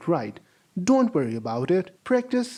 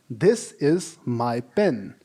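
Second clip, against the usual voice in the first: slow, careful classroom reading; slow model sentence; 5 semitones lower, slower than usual. Shown as a demonstration. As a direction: read it slowly for students. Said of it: "Let's replace the word".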